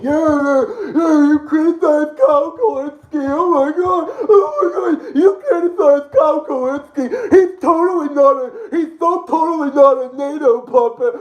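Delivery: hammed-up high whiny mock voice, half laughing; sarcastic high-pitched parody of a gushing fan. Mocking critics in whiny voice.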